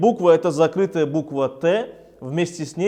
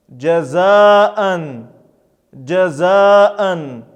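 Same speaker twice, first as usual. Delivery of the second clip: measured, drawn-out word with a falling end; Arabic reading drill; 6 dB louder, much slower than usual. Shown as a demonstration. Teacher models tanween examples for learners, careful drawn-out recitation. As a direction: recite each word singly, stretching the long vowels.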